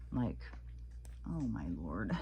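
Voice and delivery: hushed, breathy oh my Lord; whisper. Real, not acted. Vlogger watching a disgusting clip, whispering. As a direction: mutter it under your breath in disbelief.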